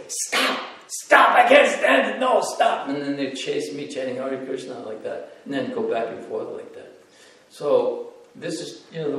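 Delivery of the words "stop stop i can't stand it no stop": high, loud pleading shrieks of stop; a hammed-up cry, much higher and louder, begging them to stop; mock anguished cries. Teller recalls begging chanting children to stop, high loud mock shrieks. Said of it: "I'd go".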